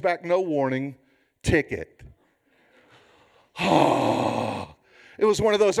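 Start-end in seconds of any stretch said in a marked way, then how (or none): 3.6-4.6 long, heavy acted sigh; exasperated sigh. Driver handed a second ticket, long exasperated sigh. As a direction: let out a big breath in disbelief.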